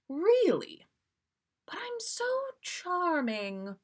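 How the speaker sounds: high, slow smug purr; mock vanity. Sirius boasting to Molly, smug. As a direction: preen over his own charm.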